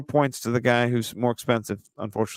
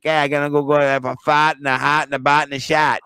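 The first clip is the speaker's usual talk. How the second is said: put-on caricatured New England accent with flattened vowels; exaggerated Northeast regional accent; mock wicked-Northeast accent. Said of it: "talk like they're from some wicked place".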